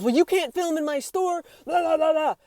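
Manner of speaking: high mocking imitation of a scolding man; aggressive scolding. Store worker tells off a woman filming, mock-aggressive. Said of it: "speaking to me really aggressively".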